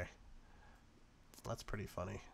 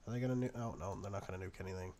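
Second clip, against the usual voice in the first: ordinary talk, nothing marked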